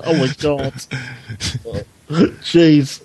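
normal talking voice

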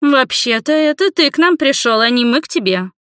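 high-pitched indignant female voice; protesting girls. A narrator's reading. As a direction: object sharply, like an affronted young woman. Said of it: "стали бастовать девушки".

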